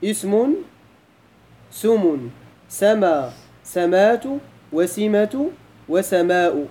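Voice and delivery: "اسم، سم، سما، سمات، وسمة، وسماء" spelled out one form at a time for counting; counting item by item. Shown as a demonstration. Teacher recounting the forms before asking how many, item by item. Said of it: "شحال من لفظ عندنا؟".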